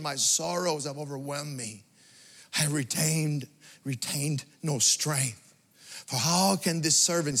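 low, soft, solemn reading of the verse; hushed scripture reading. Acted. Preacher reading Daniel's confession to the church, hushed and solemn. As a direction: read it like a man with no strength left.

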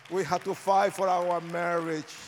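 rally them to fight for their marriage; urgent preaching. Acted. Pastor urging the congregation to fight for marriage, loud and pressing.

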